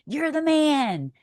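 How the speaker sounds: drawn-out, high hype cheer; admiring cheer. Retold or imitated. Wife choosing her husband, admiring cheer. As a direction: stretch it out like a cheering fan. Wife's praise retold, echoing cheer.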